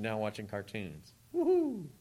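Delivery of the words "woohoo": put-on high, gleeful kid whoop; a playful mock kids' cheer. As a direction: whoop like a kid whose cartoon came on. Kids watching cartoons cheer.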